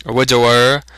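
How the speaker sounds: ordinary delivery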